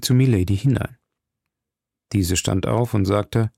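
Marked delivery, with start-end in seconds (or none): none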